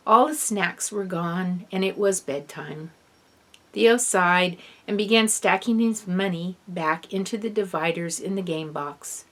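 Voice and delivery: usual speaking voice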